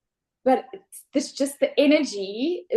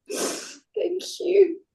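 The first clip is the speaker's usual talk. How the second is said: ordinary talk, nothing marked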